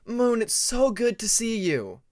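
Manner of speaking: high, loud and slow with delight; joyful greeting. A narrator's reading. Hugging his oldest friend, loud and delighted. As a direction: gush it out while hugging.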